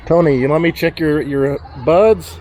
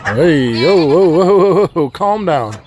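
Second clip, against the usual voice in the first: louder startled whoa; startled shout; 4 dB louder, slower than usual. Real, not acted. Farmer startled by a charging animal. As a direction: stop the rushing animal.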